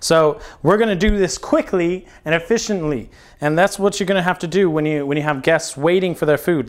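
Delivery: ordinary talk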